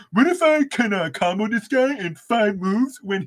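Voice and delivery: high mocking gamer whine. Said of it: "you people are like".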